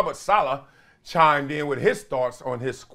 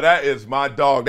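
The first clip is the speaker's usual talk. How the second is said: ordinary talk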